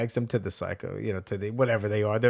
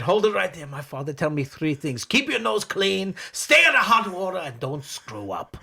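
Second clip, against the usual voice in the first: put-on worked-up comic Italian old man; old Italian husband; 7 semitones higher, 4 dB louder than usual. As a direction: build the list loudly, then drop for the last item. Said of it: "brings the guy in".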